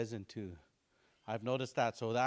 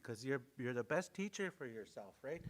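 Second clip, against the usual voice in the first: ordinary talk, nothing marked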